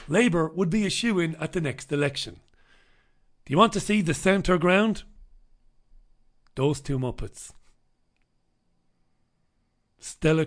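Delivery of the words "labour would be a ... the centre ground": normal talking voice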